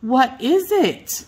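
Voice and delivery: high, slow, alarmed cry "What is it?"; anxious old hens calling out; worried hens crying out. A narrator's reading. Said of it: "they cried".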